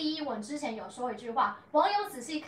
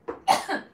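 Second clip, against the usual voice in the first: sudden choking cough on saliva; 4 dB louder than usual. Real, not acted. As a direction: cough as if saliva went down wrong. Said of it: "我被自己口水呛到".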